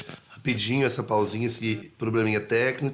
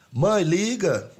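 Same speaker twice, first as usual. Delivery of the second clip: drawn-out plea; 8 semitones higher, much slower than usual. Real, not acted. Calling on mom to phone in.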